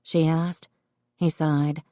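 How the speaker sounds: plain reading voice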